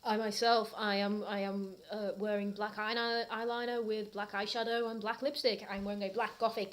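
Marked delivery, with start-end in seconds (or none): none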